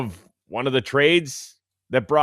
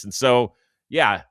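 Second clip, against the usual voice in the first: normal talking voice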